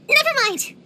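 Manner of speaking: abrupt high-pitched brush-off; flustered retreat. Acted. Stopping short of admitting fondness, flustered. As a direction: back out of the admission, pitch jumping. Cuts off a tender admission.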